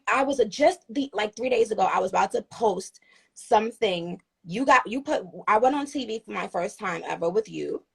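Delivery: usual speaking voice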